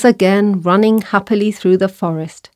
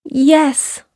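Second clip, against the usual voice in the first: high mouse voice at normal volume; little mouse; 7 semitones higher than usual. A narrator's reading. Mouse to doubting lion, high little voice. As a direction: answer as a tiny mouse, pitched high. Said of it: "said the mouse".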